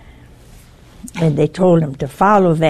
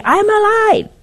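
high pleading cry; 4 dB louder than usual. Retold or imitated. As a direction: voice shoots up high, desperate. Dead man insisting to his wife.

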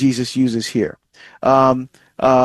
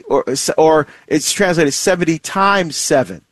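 ordinary delivery

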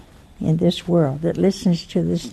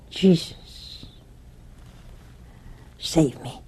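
hushed, understated frightened plea; scared plea; 7 dB quieter, slower than usual. Retold or imitated. Scared man calls on Jesus for rescue, hushed plea. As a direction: plead quietly, a touch of fear. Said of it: "Now he's scared".

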